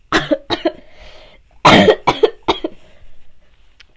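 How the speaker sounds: hard dry cough. Real, not acted. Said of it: "Really, really have to cough again".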